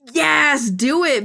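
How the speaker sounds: loud, high burst of yes; excited cheer. Real, not acted. Cheering the no A C fitting room idea, excited. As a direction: jump on the idea with glee.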